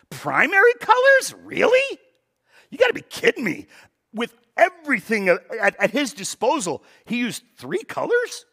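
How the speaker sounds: high, loud incredulous scoff; mock disbelief. Pastor mocking Mondrian's three colors, high incredulous disbelief. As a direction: act out scandalized amazement at three colors.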